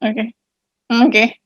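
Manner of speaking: normal talking voice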